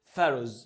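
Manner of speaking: "فاروز" starting with an f sound. Shown as a demonstration. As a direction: model "فاروز" with f for the ph. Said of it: "ال بي اتش بتنطق اف". (نطق "فاروز" بالفاء في أولها)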